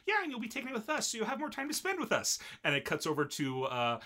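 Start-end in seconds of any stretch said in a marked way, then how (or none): 0.1-2.4 high, fast sing-song taunt; gleeful teasing. Retold or imitated. Twins tease about spending more time together, high gleeful taunt. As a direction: rattle it off in a high, mock-gleeful voice.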